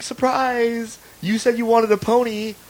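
high voice of friends springing a gift; high, put-on party surprise; imitated surprise cheer. Voicing friends springing the pony surprise.

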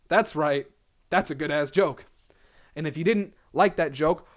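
normal talking voice

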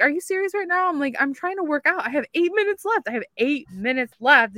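high, exasperated complaint at mom; exasperated rant. Retold or imitated. Retelling snapping at mom over the mower, exasperated. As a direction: let the frustration spill over.

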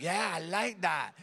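ordinary delivery

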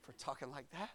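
a tender whispered-down sorry to his wife; soft apology. Retold or imitated. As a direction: murmur it gently, almost under the breath. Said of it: "Had to say".

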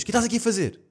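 stern question. Retold or imitated. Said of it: "e ela diz".

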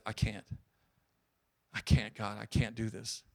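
low, soft retold plea to God; defeated prayer. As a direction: let the voice sink as if giving up.

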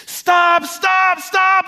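high, loud, desperate screams; shrieking stop into the crowd; screaming. Retold or imitated. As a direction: scream it to halt a street beating. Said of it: "just start screaming".

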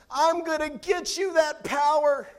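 put-on high, loud, eager kid promise; boastful kid promise. Son promising his siblings power, in a high eager voice. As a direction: promise it big like a proud boy. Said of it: "he tells Dewey and Joy".

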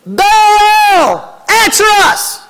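high, drawn-out desperate call; fired-up shout. Acted. Prophets calling on Baal from morning till noon, fired-up shout. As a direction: cry out to a god, stretching the name. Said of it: "They shouted. I think they were fired up".

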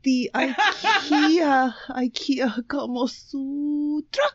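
slow, high, hushed fake Swedish accent; attempted Swedish accent. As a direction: draw out the title in mock Swedish accent. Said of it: "This is your best Swedish accent".

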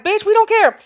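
high, dismissive mock voice; hammed-up brush-off; imitated gate staff. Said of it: "they were just like".

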